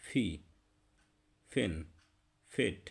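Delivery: ফি ফেন ফেট with a puffed p; breathy p on ফেট; aspirated p. Shown as a demonstration. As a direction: pop air on ফি. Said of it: "পি এর উচ্চারণটা হবে".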